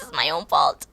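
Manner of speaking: sudden serious deadpan. Real, not acted. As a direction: drop it low and deadpan.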